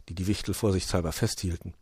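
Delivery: plain reading voice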